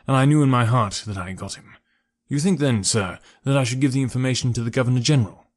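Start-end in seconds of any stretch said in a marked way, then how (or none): none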